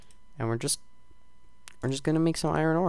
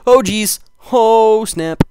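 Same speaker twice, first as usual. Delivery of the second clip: sudden loud high cry of alarm; loud startled oh snap; startled yelp; 10 semitones higher, 12 dB louder, much slower than usual. Real, not acted. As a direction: blurt it out in shock. Startled gaming exclamation.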